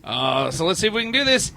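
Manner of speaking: normal talking voice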